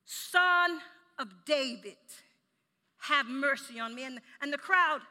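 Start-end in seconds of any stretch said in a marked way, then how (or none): 0.1-4.0 loud, slowly drawn-out plea; desperate cry. Acted. Preacher as blind man calling to Jesus, desperate cry. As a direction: call out for mercy, stretching the first words. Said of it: "There was a desperation in his voice".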